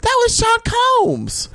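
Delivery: very high, slow awed reveal; starstruck whisper-shriek. Retold or imitated. Starstruck friend naming a celebrity, awed and slow. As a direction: draw it out in breathless awe.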